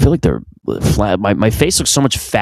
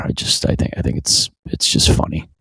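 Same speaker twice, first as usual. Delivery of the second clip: whispered aside; 8 semitones lower, 5 dB quieter than usual. Real, not acted. Quiet aside before laughing.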